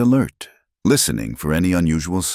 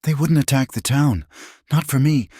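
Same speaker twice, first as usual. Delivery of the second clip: much higher, pleading in desperation; desperately; 10 semitones higher than usual. Acted. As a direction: insist frantically, voice climbing. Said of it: "Clara said desperately".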